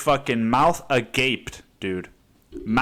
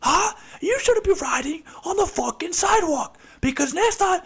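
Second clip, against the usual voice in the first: shrill, much higher mock rage, cracking into a cry; furious cyclist yelling. Angry cyclist screams at the other rider, shrill furious yell. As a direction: screech it like a petty road-rage fight. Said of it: "just be like".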